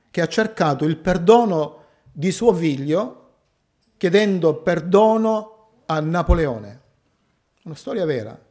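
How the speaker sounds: ordinary delivery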